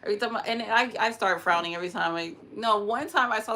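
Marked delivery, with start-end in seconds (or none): none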